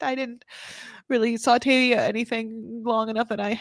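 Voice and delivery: normal talking voice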